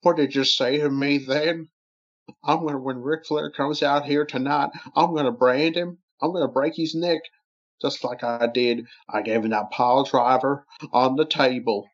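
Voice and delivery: lightly voiced wrestler trash talk; wrestler promo voice.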